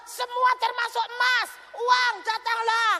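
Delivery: shouted, high money command; mimicking her frantic prayer for money, shouting. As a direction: call the money to come, hammed-up. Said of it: "Saudara lihat gaya doa nih".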